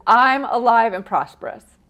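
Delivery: loud, assured mantra; enthusiastic affirmation. Shown as a demonstration. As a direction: state it with conviction. Models the mantra emphatically.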